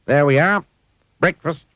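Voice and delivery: high, loud proud announcing; Clumsy serving. A narrator's reading. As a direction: show off the breakfast proudly. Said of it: "said Mister Clumsy".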